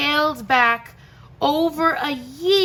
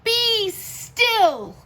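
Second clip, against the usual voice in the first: put-on commanding boy; 9 semitones higher than usual. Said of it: "Till Max said".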